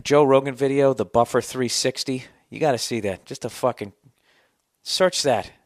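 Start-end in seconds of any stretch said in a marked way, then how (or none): none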